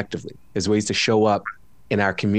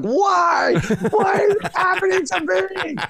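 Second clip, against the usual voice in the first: high-pitched, hammed-up wail of despair; mock scream. Guest retelling his screaming at them, mock anguished scream. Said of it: "dude, I was screaming at them".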